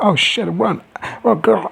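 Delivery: high-pitched urgent squeal; mock panic. Woman falling as she flees the mob, mock panic. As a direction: urge a friend to flee, hammed up.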